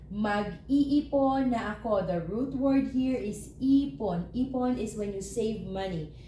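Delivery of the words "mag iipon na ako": Mag-iipon na ako spoken slowly and clearly; slow model phrase. Shown as a demonstration. Teacher modelling the next example sentence slowly. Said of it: "mag again".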